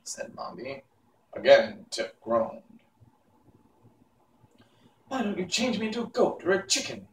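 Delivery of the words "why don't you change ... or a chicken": put-on anxious boy. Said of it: "he said anxiously".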